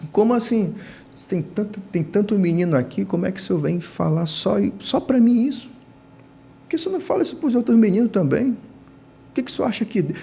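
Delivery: mild, high-pitched indignant challenge; retold indignation. Boy questioning the preacher who singles him out, indignant. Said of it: "eu fiquei invocado com ele".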